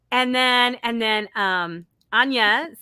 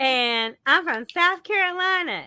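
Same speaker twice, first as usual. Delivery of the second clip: imitated South Carolina twang, pitched higher; South Carolina accent; 5 semitones higher than usual. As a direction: play up your old South Carolina accent. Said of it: "I had the accent".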